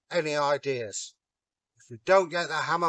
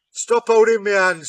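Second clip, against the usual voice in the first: 1 grumpy Thor; 6 semitones higher, 11 dB louder than usual. Acted. Thor grumbles in disguise.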